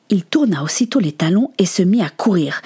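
ordinary delivery

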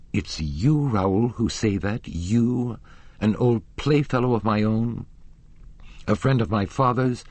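indignant. A narrator's reading. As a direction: let the hurt rise into reproach.

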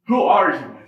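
loud, awed cry of a question; retold tearful shout. Kid to stranger who prayed with him, retold tearful shout. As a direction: call it out as if moved to tears, imitating. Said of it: "Like, tears".

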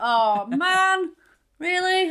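high, loud dismayed groan; deflated surprise. Retold or imitated. Tourist realises the shop assistant speaks English, high loud dismay. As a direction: let the plan collapse out loud. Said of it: "And I was like".

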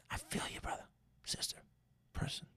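soft unvoiced whisper; hushed sympathetic whisper. Real, not acted. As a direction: lean in and whisper it. Intimate aside to listeners.

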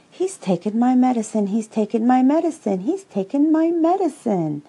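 high, loud gleeful chant, climbing on the last repeat; happy singsong. A narrator's reading. Roo, delighted Tigger took the dose, gleeful singsong. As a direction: crow it like a tremendous joke. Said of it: "sang Roo happily".

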